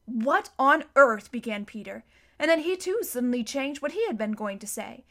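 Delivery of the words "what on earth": loud, slow, indignant surprise; loud baffled outburst; startled exclamation. A narrator's reading. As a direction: exclaim it in bewilderment, drawing out each word. Peter startled by unseen pull.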